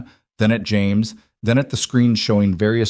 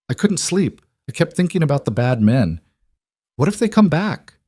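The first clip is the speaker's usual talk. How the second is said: put-on higher-pitched child's voice, anxious; worried little boy; 7 semitones higher than usual. A narrator's reading. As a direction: voice a small son who can't sleep. Worried young son voiced by narrator.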